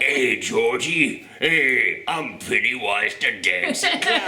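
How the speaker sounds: put-on slow old Brooklyn hobo clown; Pennywise hobo voice. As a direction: drawl it like a Brooklyn bum clown. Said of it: "an old Brooklyn hobo voice".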